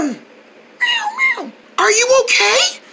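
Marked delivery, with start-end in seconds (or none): 0.0-1.5 soft, very high wail; bird distress cry. Acted. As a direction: whimper high like a hurt little bird. Hurt bird crying out.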